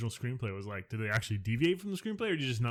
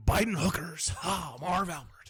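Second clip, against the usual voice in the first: higher, very slow announcer voice; Marv Albert impression; 5 semitones higher, slower than usual. Shown as a demonstration. Co-host joins in with a slow, high Marv Albert. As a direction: drag the name out like the announcer. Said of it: "your impersonation".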